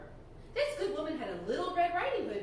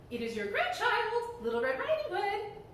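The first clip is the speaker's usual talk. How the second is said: put-on little girl; 8 semitones higher than usual. Girl answers at the door.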